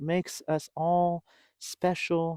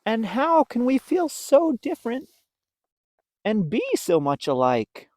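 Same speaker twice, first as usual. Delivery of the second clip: higher curious question; wondering; 10 semitones higher, 7 dB louder than usual. Acted. Pip wondering about being alike. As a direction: wonder aloud. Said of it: "wondered Pip".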